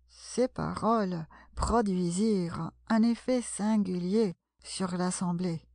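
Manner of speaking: ordinary delivery, nothing marked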